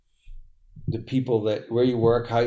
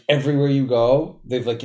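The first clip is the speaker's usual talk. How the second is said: usual speaking voice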